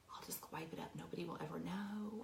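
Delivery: hushed whisper to herself; sneaky self-talk whisper; secret whisper. Retold or imitated. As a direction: whisper it like a guilty kid hiding a mess. Hiding vomit, inner thought.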